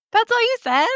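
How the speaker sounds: high-pitched, astonished question; incredulous. Real, not acted. Host reacting to the storyteller's answer at checkout, incredulous.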